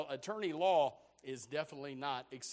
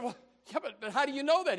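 higher, louder acted challenge; protesting client; 7 semitones higher, 5 dB louder, faster than usual. Client challenging attorney's guilty verdict, mildly indignant. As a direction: play a taken-aback client pushing back.

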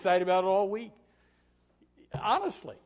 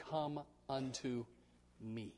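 tender plea; 3 semitones lower, 11 dB quieter, slower than usual. Acted. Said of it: "No, no, no, no".

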